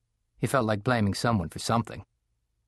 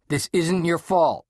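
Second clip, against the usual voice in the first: snapped; 7 semitones higher, 4 dB louder, slower than usual. A narrator's reading. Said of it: "his dad snapped".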